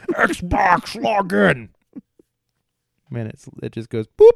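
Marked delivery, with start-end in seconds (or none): 0.1-1.7 loud, high shout at the console; yelling. Retold or imitated. Yelling at the Xbox to log in, loud and high. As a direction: bark the command at the Xbox. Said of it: "just yelling at my Xbox".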